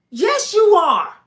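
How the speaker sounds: slow, loud, high insistence; insistent. Retold or imitated. A fan insisting to the man that he is [name], slow and loud.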